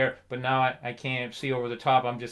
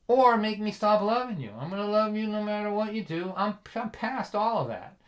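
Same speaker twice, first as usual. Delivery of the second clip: higher-pitched, emphatic declaration; insistent father; 7 semitones higher than usual. Retold or imitated. Father telling his son he'll love him regardless, insistent. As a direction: press it home as an unshakeable dad.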